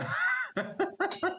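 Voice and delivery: a sudden high giggle rising; high amused laugh. Real, not acted. Host laughs when asked what he advises, high giggle. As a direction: laugh away the responsibility. Deflecting madam's question.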